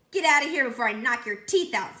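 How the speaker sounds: loud snapping threat. A narrator's reading. Allison threatening Jason on the playground, loud angry warning. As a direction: warn him off hard.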